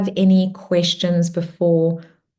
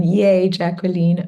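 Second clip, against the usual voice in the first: ordinary talk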